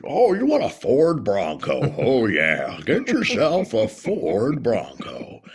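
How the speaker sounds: jowly car-ad pitchman, swooping pitch; Whataburger guy voice. Pretend. Ad voice selling a Ford Bronco, jowly burger-pitchman style. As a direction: stretch it out like a jowly announcer. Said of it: "I would wanna do the Whataburger guy".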